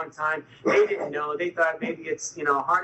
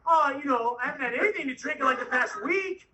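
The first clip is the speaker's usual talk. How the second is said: higher-pitched evasive excuse; mock innocence; 9 semitones higher than usual. Patient dodging doctor's question about drinking, mock innocence.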